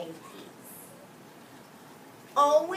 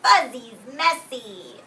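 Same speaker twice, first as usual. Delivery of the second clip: Owen's amused voice; 8 dB louder, slower than usual. Acted. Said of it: "And he's saying".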